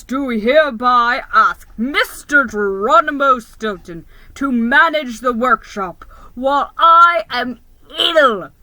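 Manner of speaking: loud, stately read-out of the decree; formal proclamation. Acted. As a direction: announce it like a royal decree. Reading Santa's letter aloud.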